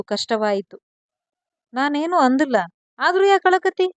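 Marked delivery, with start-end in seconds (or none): 1.7-3.9 higher, louder, worried husband pleading; rising worried husband asking again; anxious plea. A narrator's reading. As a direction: beg her to say why she cries. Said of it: "ಮತ್ತೊಮ್ಮೆ ಪ್ರಶ್ನಿಸಿದ".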